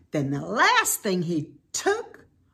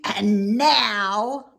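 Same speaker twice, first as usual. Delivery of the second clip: louder, sly villain growl; Grinch voice; 6 dB louder than usual. Acted. Grinch plotting to take the tree, sly villain. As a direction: gloat like the Grinch.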